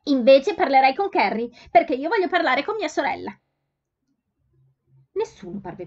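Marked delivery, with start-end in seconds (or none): 0.0-3.3 higher, much louder call over the crowd. A narrator's reading. Letty calling out to the farmer's son over the noise. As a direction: raise her voice above the shop noise.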